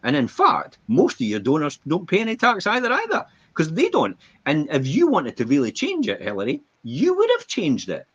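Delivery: taunting Hillary in a mock Trump voice; Trump impression. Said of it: "when he stood there with Hillary Clinton and says".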